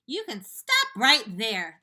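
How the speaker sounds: loud, slow, sharp rebuke. Acted. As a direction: cut him off hard. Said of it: "the earthworm said sharply".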